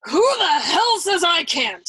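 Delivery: slow, high, loud thunder. A narrator's reading. General thundering at his son-in-law, slow and loud. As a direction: roar it to rattle the building. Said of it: "he thundered pugnaciously".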